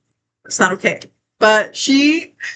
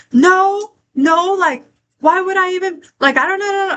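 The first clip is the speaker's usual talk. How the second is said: pitched higher, whiny mock denial; mocking Chaos denying; 7 semitones higher than usual. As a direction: play the accused girl, protesting too much. Friends mimic Chaos denying it.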